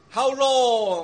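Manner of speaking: a prophet's desperate loud plea; crying out. Retold or imitated. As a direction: cry out to God in despair. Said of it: "for which he cried out".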